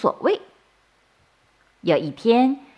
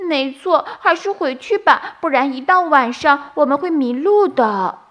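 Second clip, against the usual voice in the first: worried bunny; 7 semitones higher, 3 dB louder than usual. A narrator's reading. Bunny suggests going home.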